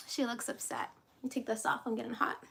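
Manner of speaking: ordinary delivery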